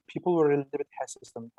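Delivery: ordinary talk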